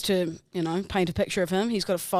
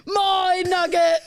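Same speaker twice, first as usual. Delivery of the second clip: put-on high, louder greedy-child squeal through laughter; greedy kid, laughing; 8 semitones higher, 4 dB louder, much slower than usual. Voicing her greedy young self while laughing. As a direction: grab the food like a greedy little kid.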